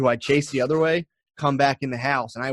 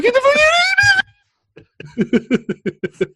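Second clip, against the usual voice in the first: very high, loud yell; mock war yell; 6 dB louder than usual. Retelling sprinting at the neighbors, high yell. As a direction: scream it while sprinting. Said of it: "being like".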